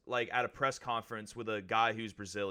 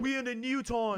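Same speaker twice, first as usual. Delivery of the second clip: put-on Irish accent; 11 semitones higher than usual. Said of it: "you sound like Orlando".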